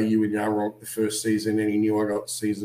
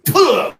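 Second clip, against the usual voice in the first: loud, explosive "puh"; spitting out; 10 dB louder than usual. Retold or imitated. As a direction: spit it out hard. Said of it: "And I've just gone".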